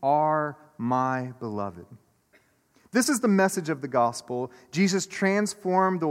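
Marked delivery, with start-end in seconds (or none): 0.0-1.9 very slow and tender; voice of God's blessing. Acted. God's voice declaring love over his people, slow and tender. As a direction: linger over each word like a father's blessing. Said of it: "That same voice says".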